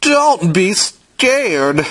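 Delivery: ordinary delivery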